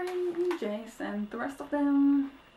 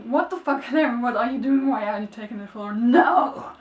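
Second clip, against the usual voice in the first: shocked shout; 9 dB louder than usual. Retold or imitated. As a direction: yell at Clary in disbelief. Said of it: "I was like".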